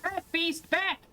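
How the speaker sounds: high-pitched order to retreat; commanding cry. Real, not acted. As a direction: drive a creature back with a high cry. Ordering creature away from hat.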